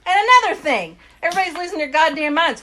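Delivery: loud, high, fast burst of outrage; outburst. Real, not acted. Ranting to her friend about Greenland, loud and high. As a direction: explode into the next rant. Launches into an angry rant.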